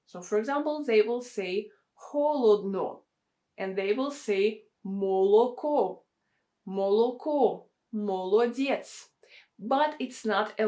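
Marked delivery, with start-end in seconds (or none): 2.0-3.0 northern Russian "холодно" as ho-lod-no, every O sounded; northern Russian dialect. Shown as a demonstration. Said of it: "they will pronounce it as O". 4.9-9.1 northern Russian full O's in "молоко" and "молодец", mo-lo-KO, mo-lo-DYETS; northern Russian dialect. Shown as a demonstration. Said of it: "there is a special dialect".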